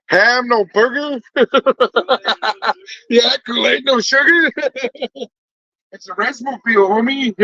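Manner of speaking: high-pitched joking, laughing between phrases; joking with laughter. Real, not acted. Vendor joking with customers about rez food, laughing. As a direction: crack yourself up with each quip.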